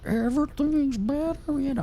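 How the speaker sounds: spooky voice. Shown as a demonstration. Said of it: "spooky voice".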